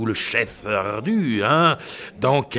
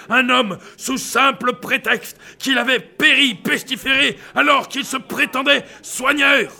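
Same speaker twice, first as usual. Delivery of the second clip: rising frenzy; 8 semitones higher than usual. Acted. Rising conspiracy rant.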